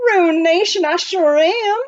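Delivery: put-on a gruff old miner, high and loud; rough miner voice. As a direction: bark it like a grizzled prospector.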